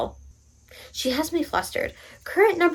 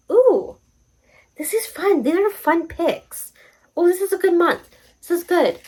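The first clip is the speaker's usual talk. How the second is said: higher, delighted gushing; excited; 7 semitones higher than usual. Real, not acted. Vlogger thrilled by her prompt picks, higher and excited. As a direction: gush over the picks.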